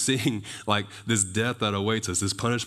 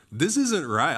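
higher-pitched mock outrage; mildly hammed-up indignation; indignant Goliath; 6 semitones higher, 4 dB louder, slower than usual. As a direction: act out an offended giant, lightly. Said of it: "he's mad".

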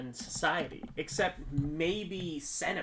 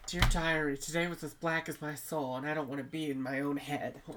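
put-on teen girl diary voice. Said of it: "a fifteen-year-old girl wrote our script".

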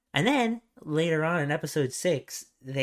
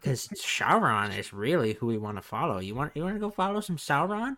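put-on sly old man. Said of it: "And he's like".

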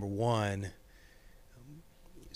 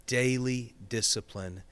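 ordinary talk

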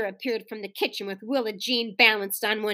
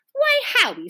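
high, shocked reprimand; scolding; 12 semitones higher than usual. A narrator's reading. Mother embarrassed by son's remark, scolding. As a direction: scold with the first word pitched way up. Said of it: "scolded Missus Kemp".

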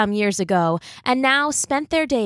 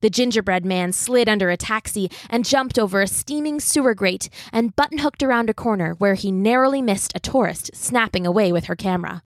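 ordinary delivery

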